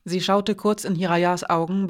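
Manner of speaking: ordinary delivery, nothing marked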